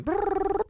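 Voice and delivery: high trilled ring imitation; phone ringing sound. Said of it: "create for us theater of the mind".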